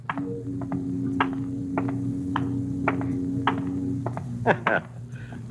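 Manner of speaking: drawn-out low mocking laugh; a long, self-satisfied laugh; smug chuckle. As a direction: chuckle softly at the visitor. Host laughs at guest.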